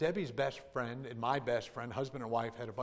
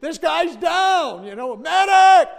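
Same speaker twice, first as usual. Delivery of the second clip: full-throated shout, much higher and louder; screaming for a medic; 17 dB louder, slower than usual. Retold or imitated. Panicked man shouting for a medic in church. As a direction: yell it across the church for help. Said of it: "and I'm screaming".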